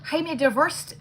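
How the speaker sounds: badly faked Scots lilt; Scottish accent. Shown as a demonstration. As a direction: attempt a shaky-at-best Scottish accent. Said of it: "try to do my Scot-, Scottish accent".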